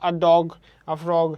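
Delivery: "dog" and "frog" hit loud after a light "a"; stressed nouns. Shown as a demonstration. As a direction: punch "dog" and "frog", never the "a". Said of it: "কারণ এ হচ্ছে এখানে কিন্তু bold না". ("a" হালকা, "dog" আর "frog"-এ জোর)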